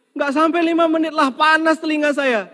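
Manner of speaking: very high, loud squeaky spirit voice; squeaky jinn. Acted. Jinn explaining to the husband why he fled the well, very high and loud. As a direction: play the jinn as a comic squeaker.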